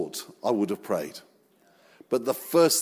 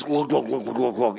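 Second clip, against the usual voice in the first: rapid imitated gulping glugs; drowning glugs. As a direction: mimic someone going under, gurgling. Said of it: "Peter's starting to drown. And he's".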